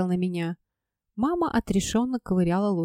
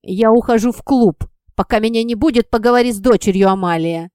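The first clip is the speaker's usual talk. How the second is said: curt tossed-off order; 7 dB louder than usual. A narrator's reading. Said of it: "бросил он".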